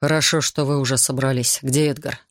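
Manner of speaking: usual speaking voice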